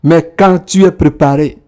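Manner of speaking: usual speaking voice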